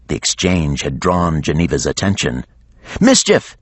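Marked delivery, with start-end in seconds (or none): 3.0-3.6 much higher, louder call; yell. A narrator's reading. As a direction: shout across the deck. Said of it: "she yelled".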